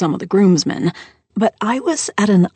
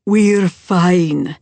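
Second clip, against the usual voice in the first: deep baritone; 5 dB louder than usual. Acted. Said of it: "in that deep baritone of his".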